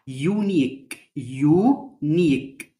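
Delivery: يونيك drawn out slowly, you-neek; slow model word. Shown as a demonstration. Teacher stretching unique for the class. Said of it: "اليو والإي في الآخر ما نطقناهمش".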